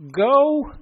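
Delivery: God ordering Elijah out of the cave, loud; God's command. Acted. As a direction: call it out loud and high like God sending him off. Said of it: "God's response to Elijah was this".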